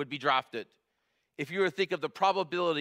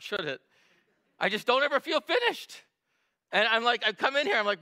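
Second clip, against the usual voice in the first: much higher and louder, fast and urgent; full-force preaching; 6 semitones higher, 4 dB louder than usual. Acted. Pastor confessing to the church he never feels finished, impassioned. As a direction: pour it out like a confession from the pulpit.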